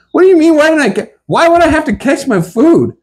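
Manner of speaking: high, fast, incredulous protest; can't believe he has to catch his own food; incredulous outrage. Retold or imitated. Protesting to the waitress, high and fast in disbelief.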